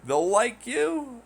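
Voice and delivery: high, slow scoffing question; sarcastic disbelief. Real, not acted. Scoffing at being liked for lying, slow sarcastic. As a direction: scoff at the idea.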